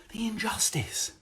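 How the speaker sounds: whispered in mock horror; hushed, incredulous whisper; mock outrage whisper. Comic repeating someone's complaint about pubs, incredulous whisper.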